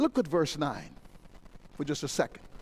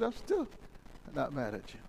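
much lower and softer, calm God; gentle God; 7 semitones lower, 10 dB quieter than usual. Acted. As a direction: reassure softly as God.

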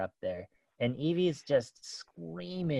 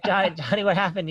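put-on higher, caring call to a child; concerned gentle call; 6 semitones higher, 4 dB louder, faster than usual. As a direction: reach out kindly to a hurt little boy. Mom checks on crying son.

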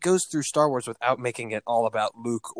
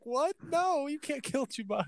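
mock disbelief; 12 semitones higher, 7 dB quieter than usual. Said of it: "I was like".

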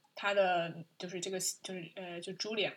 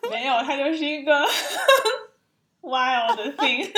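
high, loud and giggling; laughing; 7 semitones higher, 12 dB louder, slower than usual. Real, not acted. Host insists to co-host he is a wild thing, laughing. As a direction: burst out laughing mid-line.

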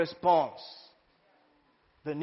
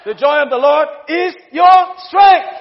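higher, much louder strength; shouted proclamation; 6 semitones higher, 11 dB louder than usual. Acted. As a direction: blast the line to the church. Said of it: "repeated louder".

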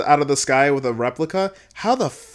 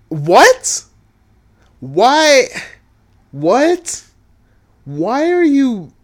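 high, loud exclamations of disbelief; baffled outburst; 10 semitones higher, 8 dB louder, much slower than usual. Real, not acted. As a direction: demand an answer, voice shooting way up. Baffled outburst at plot twist.

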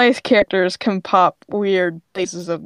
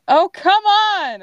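loud, high, slow exasperated cry; exasperated oh come on; 11 semitones higher, 6 dB louder, slower than usual. Acted. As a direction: groan it out at the sky, fed up. Landing in the wrong AU again.

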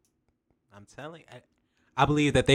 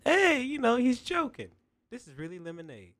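high, naive trusting voice; gullible voice; 11 semitones higher, 8 dB quieter than usual. Retold or imitated. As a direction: play the sucker who believes it. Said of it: "But now you thinking".

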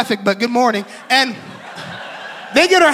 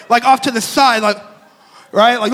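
ordinary talk, nothing marked

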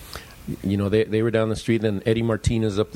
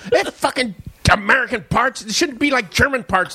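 high, laughing half-angry rant; mock rant; 11 semitones higher than usual. [name] blames American parts, laughing mock rant. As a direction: rant about the parts while cracking up.

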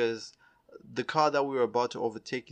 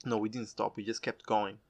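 ordinary talk

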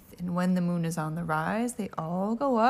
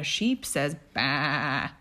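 bleating sheep; 4 semitones lower, much slower than usual. Acted. Sheep sound.

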